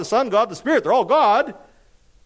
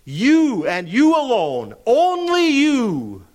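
slow, forceful stress on each "you"; drawn-out, ringing emphasis on you; emphatic YOU; 7 semitones higher, 4 dB louder, much slower than usual. Real, not acted. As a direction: hammer "you", climbing higher on "only you". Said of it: "that's emphatic in the Greek".

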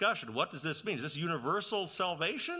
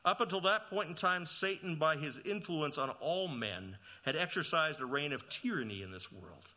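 ordinary talk, nothing marked

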